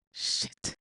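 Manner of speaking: a soundless shocked whisper; whispered curse. Acted. Narrator realizing the truth, whispered curse. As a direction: breathe the curse out, no voice.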